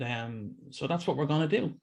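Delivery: usual speaking voice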